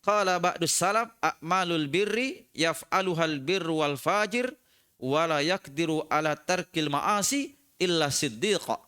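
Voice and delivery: slow, measured reading of a classical Arabic text; chanting the quoted scripture slowly; slow Arabic recitation. Acted. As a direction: recite it slowly, weighing each phrase.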